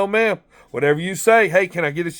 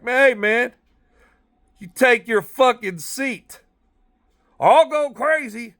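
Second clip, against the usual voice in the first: mock yell; 6 semitones higher, slower than usual. Yelling at inmate hitting door.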